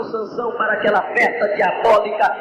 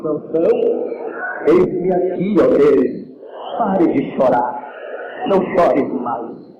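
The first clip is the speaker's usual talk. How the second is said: God answering weeping Samson, lower and solemn; 6 semitones lower than usual. Acted. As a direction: speak as God, calm and weighty. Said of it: "De repente Deus diz".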